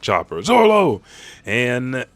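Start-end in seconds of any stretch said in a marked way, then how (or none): 0.4-1.0 high, loud cry of the name; alarmed shout. Retold or imitated. Chopper sees Zoro hit in the face, shouting. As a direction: yell it as if he's been hit.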